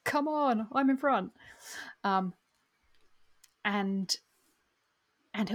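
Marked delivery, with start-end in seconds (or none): 0.1-1.3 officer's call. Retold or imitated. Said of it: "you're yelling your men to come with you".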